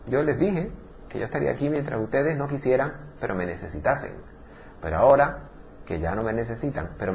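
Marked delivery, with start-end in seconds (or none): none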